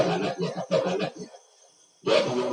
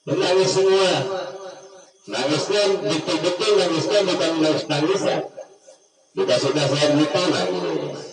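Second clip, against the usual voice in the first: put-on raised, impassioned preacher's voice pressing the children; fervent preaching; 7 semitones higher, 4 dB louder than usual. Ustaz pressing the children to truly weep, in fervent raised preaching. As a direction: preach it hard, voice lifted high.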